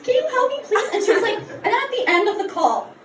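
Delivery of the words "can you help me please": high, mock-desperate begging; hammed-up helpless plea; desperate pleading. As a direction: beg the therapist for help, pitched up. Pleading with therapist.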